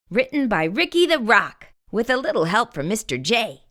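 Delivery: ordinary delivery, nothing marked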